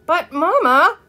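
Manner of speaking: high, loud whine of protest; protesting child. A narrator's reading. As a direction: object to Mama as a wronged little girl. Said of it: "protested Ramona".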